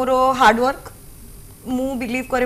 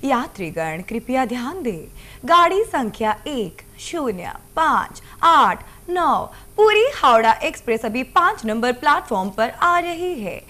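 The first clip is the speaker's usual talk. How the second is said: echoing train announcement; 2 semitones lower than usual. Shown as a demonstration. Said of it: "ଆପଣଙ୍କଠୁ ନିହାତି ଭାବରେ ଶୁଣିବାକୁ ଚାହିଁବା".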